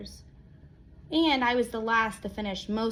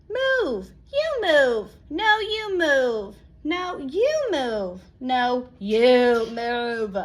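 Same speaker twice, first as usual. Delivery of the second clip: bickering roommates; 5 dB louder, much slower than usual. Acted. Cookie roommates fighting over space, bickering. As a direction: shove back at each other, slower each time.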